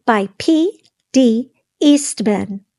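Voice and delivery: plain reading voice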